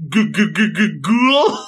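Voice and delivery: high, wobbling mock-terrified stutter; cartoon scared stammer. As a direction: stutter it like a cowardly cartoon character. Scooby-Doo style frightened stammer.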